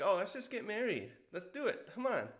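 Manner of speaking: acted breezy, high-pitched urging; eager coaxing. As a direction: playfully push someone into it, pitch swooping up. Acted casual marriage proposal in retelling.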